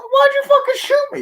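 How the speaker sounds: very high, loud squeal of disbelief; shrieking outrage. Acted. Shrieking disbelief at being shot.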